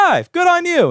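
high, loud cheer; mock congratulation. Congratulating coworker on taking the train, hammed-up high cheer. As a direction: call it out with hammed-up enthusiasm.

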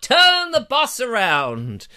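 hammy panicked shout, slowing at the end; man overboard shout. Pretend. Joke alarm cry.

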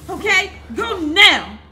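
high, loud frantic shouting. Real, not acted. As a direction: yell at them to flee. Urging escape from danger.

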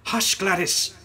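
loud, high sharp rebuke; sharp hush. A narrator's reading. Lady silencing her taunting sister, sharp. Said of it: "I hissed".

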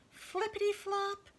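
playful high sound word; high flippity flop. Acted. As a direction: bounce it up high. Jump sound in story.

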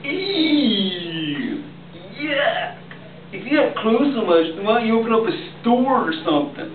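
high, mocking sarcasm; sarcastic. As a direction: sneer it, high and fast. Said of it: "they said".

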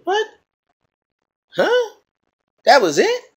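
shocked squeaky high disbelief; an outraged crowd can't believe it ended; baffled audience. Retold or imitated. Moviegoers at the abrupt ending, squealing high disbelief. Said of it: "Well, they said".

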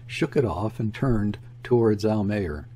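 ordinary delivery, nothing marked

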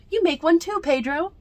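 loud high coaxing; excited urging. Acted. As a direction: coax the cousin to join. Children urging Pedro.

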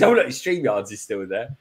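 normal talking voice

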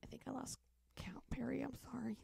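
soft apologetic whisper. Real, not acted. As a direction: whisper it as an aside.

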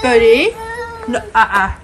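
sharp loud no to dog; scolding dog. Real, not acted.

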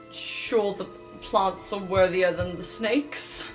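ordinary talk, nothing marked

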